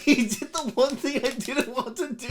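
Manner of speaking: very high, laughing complaint; helpless laughter. Real, not acted. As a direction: whine it out through giggles. Helpless giggles after failing not to laugh.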